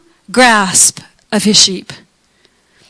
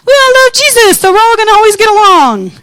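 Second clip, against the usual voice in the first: mock naive voice; 9 semitones higher, 3 dB louder than usual. Mocking naive churchgoer attitude.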